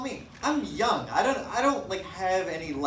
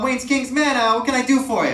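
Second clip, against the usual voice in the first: phone greeting; 9 semitones higher, 9 dB louder than usual. Retold or imitated. Barber answering the phone.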